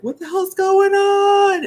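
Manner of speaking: high, slow, alarmed voice for the dogs; dogs' voice. Retold or imitated. Woman voicing her alarmed dogs, high and slow. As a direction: voice the panicked dogs. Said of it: "the dogs are freaking out, like".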